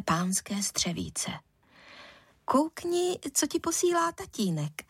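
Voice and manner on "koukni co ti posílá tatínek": high-pitched, awkward offering; a touch of embarrassment behind a high, coaxing line; poorly hidden embarrassment. A narrator's reading. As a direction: try to hide your unease. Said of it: "se špatně skrývanými rozpaky".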